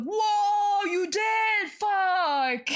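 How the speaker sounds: high, loud astonished shout; shocked surprise. Retold or imitated. Aunt reacting to her nephew's new haircut with a loud, high astonished shout. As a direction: blurt it out, totally floored.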